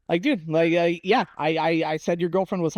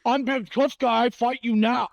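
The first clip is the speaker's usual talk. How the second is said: higher, slow, mocking tough-guy voice; 6 semitones higher, slower than usual. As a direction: ham up a wannabe tough guy, slow and high. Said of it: "he wants to be like".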